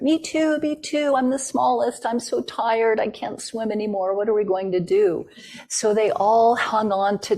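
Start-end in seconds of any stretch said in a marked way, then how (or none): 0.0-5.3 much higher, small squeaky animal voice; tiny tired muskrat. Acted. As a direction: play the smallest, most worn-out critter. Little muskrat voiced in story.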